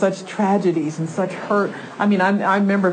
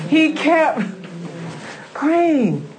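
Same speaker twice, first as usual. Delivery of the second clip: much higher, a laugh in it; excited emphasis; 8 semitones higher, much slower than usual. Real, not acted. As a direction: lift it up with joy. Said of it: "repeated for emphasis".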